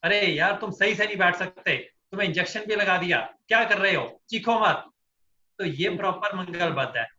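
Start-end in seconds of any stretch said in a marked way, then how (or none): 0.0-4.8 raised, hurried scolding of a patient; scolding dentist. Acted. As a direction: act out an exasperated, scolding dentist. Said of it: "तो वो dentist क्या बोलेगा".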